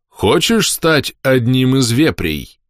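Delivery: put-on slow, simple, as to a child. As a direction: spell it out slowly like to a kid. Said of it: "говорил просто и доходчиво, как разговаривают с ребёнком". (медленно и просто, как ребёнку)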